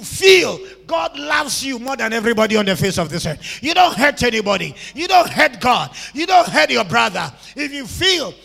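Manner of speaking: loud, insistent preaching; preacher shouting reassurance at the church; full force preaching. Real, not acted. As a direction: pound each line.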